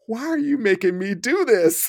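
pleading client. Retold or imitated. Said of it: "he just said".

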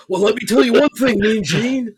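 loud promo voice aimed at Mean Gene; wrestling promo. Pretend. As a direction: grab the mic like a wrestler. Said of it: "This turned into a wrestling promo?".

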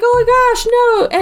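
high-pitched retold dismay; hammed-up cry of dismay; mock horror. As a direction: imitate horrified friends, voice way up. Retold horror at slashed throat.

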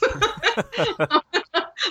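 high laugh going up at the end; bright amused laugh; rising laugh. Real, not acted. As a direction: laugh at your own bluntness. Laughs at her own confession.

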